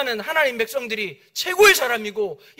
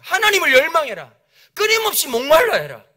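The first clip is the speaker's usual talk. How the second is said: raised, urgent preaching cry; impassioned exhortation; 5 semitones higher, 6 dB louder than usual. Retold or imitated. Preacher quoting a pastor's call to Christians, impassioned exhortation. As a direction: drive each command home, building to a high, loud peak.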